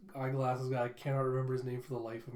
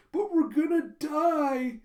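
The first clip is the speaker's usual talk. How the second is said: much higher, louder, slow terrified whine; a drawn-out high panicked cry; panicked wail; 12 semitones higher, 8 dB louder, slower than usual. Retold or imitated. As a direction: wail it in terror. Still terrified on rapids.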